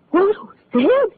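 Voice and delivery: high, sharp disbelieving cry; the news hits her like a slap; stunned rising question; shocked. Acted. Learns her blackmailer is dead.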